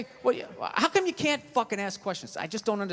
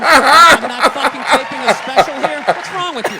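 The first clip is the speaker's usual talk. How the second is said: loud, mocking heckler shout; mock heckler yell; 10 dB louder than usual. Comedian mocking the heckler who walked around, loud yelling. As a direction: bellow it like the rude audience member. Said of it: "you're, like, walking around".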